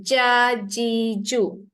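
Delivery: syllable by syllable, "Ja, ji, ju" from the middle of the tongue; letter drill. Shown as a demonstration. Teacher drilling the jim sounds for the student. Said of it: "rasakan lidah tengah-tengah tu naik".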